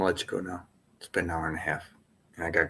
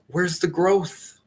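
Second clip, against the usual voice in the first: slow, higher mock demand, Where's the growth drawn out; mock pushy coach; 7 semitones higher, much slower than usual. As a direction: slowly needle them with Where's the growth. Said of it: "I'm not gonna then say, like".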